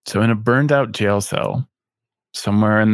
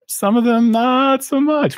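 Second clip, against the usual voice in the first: teasing; 13 semitones higher than usual. Pretend. Teasing aside about the guests.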